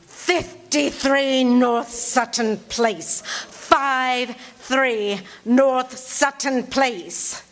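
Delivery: very slow, every word spaced out; very slow dictation. Acted. Caller dictates her address to the sergeant, very slow. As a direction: read the address like he's taking it down.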